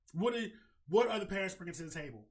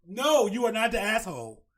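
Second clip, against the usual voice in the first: loud and firm; emphatic loud verdict; 4 semitones higher, 7 dB louder than usual. Real, not acted. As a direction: declare it loudly, firmly.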